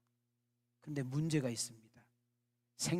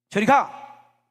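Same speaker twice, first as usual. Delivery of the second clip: a sudden, much higher, much louder yell; angry shout; 7 semitones higher, 16 dB louder, much slower than usual. Acted. Blinded young man yells at the pestering girl, furious shout. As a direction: snap it out to drive the girl away. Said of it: "탁 소리를 질렀어요".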